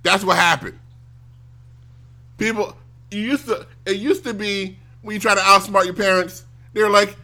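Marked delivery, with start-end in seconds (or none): none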